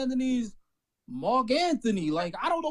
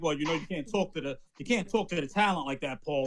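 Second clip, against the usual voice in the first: mock stern boss; 7 semitones lower than usual. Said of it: "they're like".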